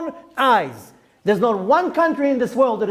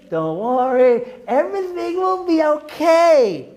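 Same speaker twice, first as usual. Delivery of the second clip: higher, slow sugary parody of false comfort; mock reassurance; 5 semitones higher than usual. Rabbi mocking people who give false hope, syrupy mock calm. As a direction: sneer at the comforters by sounding sweetly naive. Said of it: "some people have the nerve to say".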